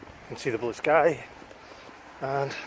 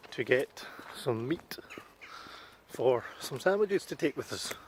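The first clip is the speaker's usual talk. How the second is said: whispering; 9 dB quieter than usual. Real, not acted. Whispered aside in shop.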